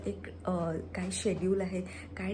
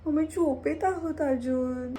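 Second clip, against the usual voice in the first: put-on high, louder baby voice for the pet; cutesy pet voice, higher and louder; 7 semitones higher, 6 dB louder than usual. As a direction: speak for the pet like a toddler. Voicing the sleepy pet.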